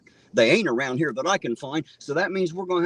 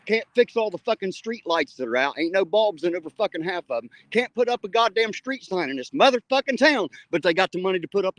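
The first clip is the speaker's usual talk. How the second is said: furious rant; 6 semitones higher than usual. Real, not acted. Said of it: "I'm so goddamn mad I could bite nails".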